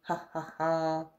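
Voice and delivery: low, soft sly chuckle; thieves' chuckle. Acted. The two thieves chuckling over their cunning plan, a low quiet chuckle. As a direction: chuckle quietly like a smug thief. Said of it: "The two thieves chuckled".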